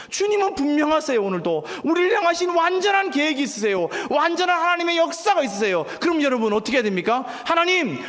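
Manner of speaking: high, fervent sermon climb; fervent preaching. Acted. Pastor urging the congregation not to give up, fervent preaching. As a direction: preach it to rouse the church.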